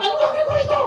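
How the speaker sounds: high-pitched, exasperated retort; indignant complaint. Acted. Acted complaint over small pay handed over.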